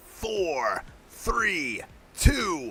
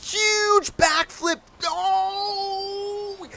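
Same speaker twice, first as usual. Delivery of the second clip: much higher, slow, soaring call; ecstatic call; much slower than usual. Real, not acted. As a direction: lose it as the big trick lands. Climactic trick, commentator erupts.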